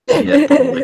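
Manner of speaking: high, loud laughing yes. Real, not acted. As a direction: laugh out the agreement. Laughing at inbreeding joke.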